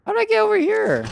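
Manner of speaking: high bewildered squeak; baffled high question; confused. Real, not acted. Confused where he is.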